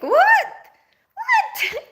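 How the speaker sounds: high-pitched disbelieving what; shocked, voice shooting up in disbelief; incredulous. Real, not acted. Vlogger reacting in disbelief to unfair judgments, squeaky incredulous.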